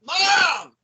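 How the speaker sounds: high, loud holler for Mom; hammed-up high shout; mock yell for mom. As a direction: yell it out like a kid calling for mom. Calling out for mom.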